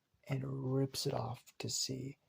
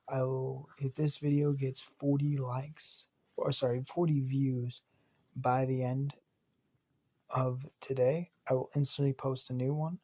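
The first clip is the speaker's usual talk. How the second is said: usual speaking voice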